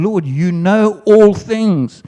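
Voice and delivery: slow, high, pleading; Peter's hurt reply. Acted. Peter answering Jesus, slow and pleading. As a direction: draw it out as a wounded plea.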